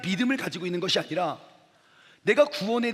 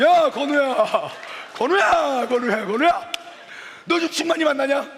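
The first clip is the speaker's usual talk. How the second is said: loud, high, booming call from a big guy; hammed-up big-bodied shout; huge friend; 5 semitones higher, 5 dB louder than usual. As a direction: bellow at your friend like a hulking giant. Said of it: "이만한 덩치로".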